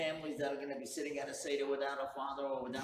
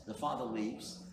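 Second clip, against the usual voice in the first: ordinary delivery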